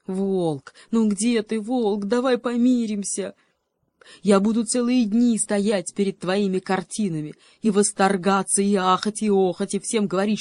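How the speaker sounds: a freezing cat begging for help; desperate shout. Acted. Cheetah calling the wolf, loud and desperate. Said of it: "закричал отчаянным голосом".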